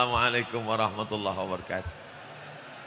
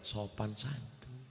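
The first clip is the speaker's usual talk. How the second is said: Sopan santun said much softer and slow, gently; softened; 2 semitones lower, 13 dB quieter, slower than usual. Shown as a demonstration. Preacher softening his voice after being called harsh, quiet and slow. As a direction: hush it down to show he can be gentle. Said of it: "kalau gitu kita perlembut".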